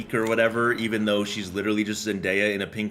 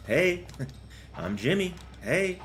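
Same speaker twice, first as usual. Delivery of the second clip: giggly mock greetings; 7 semitones higher, 6 dB quieter, slower than usual. Laughing cartoon roll call.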